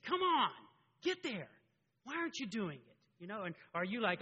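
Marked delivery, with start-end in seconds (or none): 0.0-3.0 high, loud impatient coach yell. Pretend. An impatient coach God to a struggling child, angry yelling. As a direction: push them harshly to perform.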